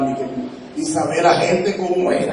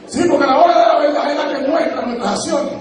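high, sung-out preaching cry; much higher, half-sung shout; 10 semitones higher than usual. Real, not acted. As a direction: belt it out like a preacher at the climax.

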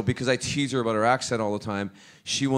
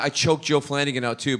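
usual speaking voice

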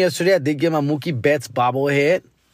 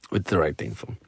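dropped-down, softer repeat; low aside; 14 semitones lower, 7 dB quieter than usual. Real, not acted. Host concluding his bobblehead story, low muttered aside. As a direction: sink the voice very low, quieter.

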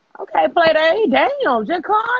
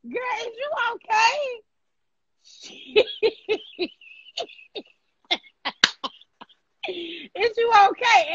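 laughing; 8 semitones higher, slower than usual. Real, not acted. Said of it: "she did all this stupid ass laughing".

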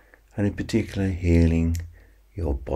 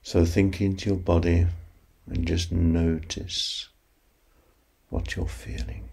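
slow counting hypnosis voice; guided sleep meditation. Acted. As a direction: ease them toward sleep, unhurried.

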